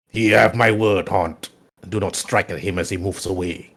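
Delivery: low, measured villain order; dark lord laying down an order; commanding Sith lord. Acted. Game master voices Scourge.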